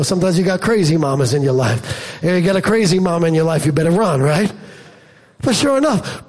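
ordinary talk

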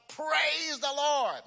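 high, drawn-out proclamation of praise; joyful shout. Acted. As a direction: shout it from the front porch.

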